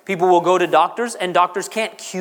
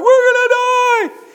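high slow mock wail of panic; 14 semitones higher, 5 dB louder, slower than usual. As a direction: play the frightened disciples, hammed up. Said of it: "They're like".

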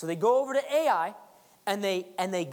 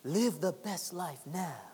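mock ad slogan; 4 semitones lower, 5 dB quieter, slower than usual. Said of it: "credit card commercials".

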